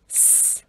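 "s" as a long voiceless hiss; isolated s sound. Shown as a demonstration. Teacher shows viewers the final sound, "s" hissed alone. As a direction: hold "s" as a pure hiss, no vowel. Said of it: "Âm cuối chúng ta phát âm là".

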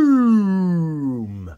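a big, loud, high falling shout; loud boom. Real, not acted. Celebrating a pull for the viewers, loud boom. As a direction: slam the word down like an explosion.

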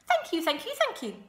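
high, loud, rapid-fire thanks; gushing thanks. A narrator's reading. Goose bowing to applause, gushing thanks. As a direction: gush it like an honoured goose.

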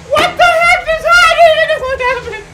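high stunned cry; can't believe it; shocked disbelief. Real, not acted. Disbelief after game event.

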